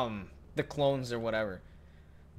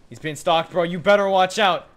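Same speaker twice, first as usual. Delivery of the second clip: excited warning; 5 semitones higher, 10 dB louder than usual. Real, not acted. Watching friend hunted.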